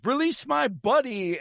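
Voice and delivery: high, slow mock royal command; Prince John. Villain prince suddenly halting the execution, hammed-up high command. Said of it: "Prince John is like".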